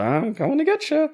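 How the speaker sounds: high, far creepy taunt; menacing invader. Retold or imitated. Acting out a Dark Souls invader.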